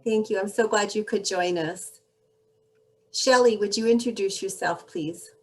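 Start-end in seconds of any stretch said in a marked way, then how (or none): none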